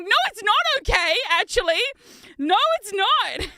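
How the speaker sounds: loud, high insistent no; loud high protest; firm objection. Retold or imitated. Arguing back at sister-in-law.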